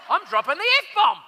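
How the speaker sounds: hamming up the big reveal; mock announcement. Host teasing the listener before a swear, loud. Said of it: "You ready for this?".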